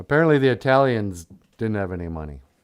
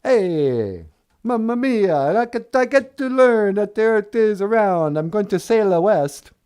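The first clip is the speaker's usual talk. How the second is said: high, hammed-up Italian accent; 9 semitones higher, 3 dB louder than usual. Columbus vowing to sail west, cartoon Italian accent. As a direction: go broad mock-Italian, pitched up. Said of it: "that was his accent".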